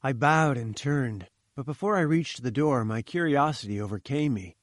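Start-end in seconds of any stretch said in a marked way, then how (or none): none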